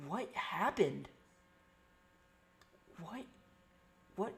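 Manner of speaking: ordinary delivery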